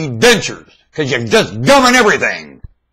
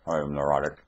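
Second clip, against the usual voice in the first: much lower and softer, another self speaking; puka voice; 8 semitones lower, 10 dB quieter, faster than usual. Pretend. Puka answering the prompt, low and soft. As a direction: drop low and soft as the inner voice. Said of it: "That tone of voice, that's puka".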